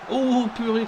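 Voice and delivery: exasperated loud cry; excited sports commentary. Real, not acted. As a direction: shout in disbelief. Chance missed in the game.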